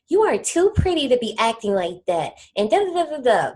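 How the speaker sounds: light mock sweet reasoning voice; a touch of a soothing girlfriend; imitating a girl. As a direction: coax like a girl calming someone down. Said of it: "a girl be like".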